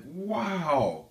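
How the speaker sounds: a high, loud gasp of amazement at the find; stunned wow. Retold or imitated. Stunned wow as the license falls from the package. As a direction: breathe out the wow, truly floored.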